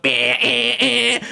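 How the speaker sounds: high, droning buzzer blasts; imitated door buzzer. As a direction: mimic leaning on a buzzer, repeated bursts.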